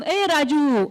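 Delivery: loud village-style call; demonstrated shout. Shown as a demonstration. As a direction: call out to someone far off. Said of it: "आपण ओरड".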